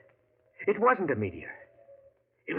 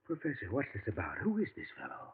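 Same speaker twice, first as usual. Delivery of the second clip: uneasy hush; 3 semitones lower, 12 dB quieter than usual. Acted. Assistant confused, uneasy.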